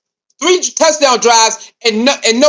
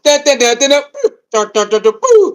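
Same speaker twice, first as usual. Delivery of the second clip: game sound imitation. Imitating Duck Hunt game sounds.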